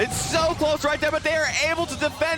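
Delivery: high, urgent casting; excited play-by-play. Real, not acted. Live play-by-play climax.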